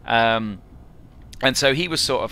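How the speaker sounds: ordinary delivery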